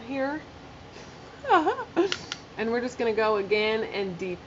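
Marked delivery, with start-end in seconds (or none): 1.4-2.4 short high laugh. Real, not acted. Laughs at her sexpert joke.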